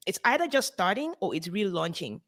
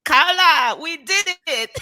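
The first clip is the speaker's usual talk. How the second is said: put-on high, loud Cameroonian accent; excited Cameroonian voice; 9 semitones higher, 6 dB louder, slower than usual. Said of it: "I'm gonna use my Cameroonian accent".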